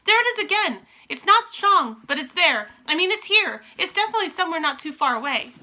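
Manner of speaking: high, loud eager sniffing; Mildred tracking the smell; excited witch. Acted. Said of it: "shouted the first witch".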